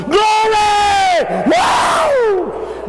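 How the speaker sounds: high, drawn-out holler of joy; jubilant shout. Retold or imitated. Son hearing his dad got saved, shouting for joy. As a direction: whoop it out at full voice. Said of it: "I started shouting".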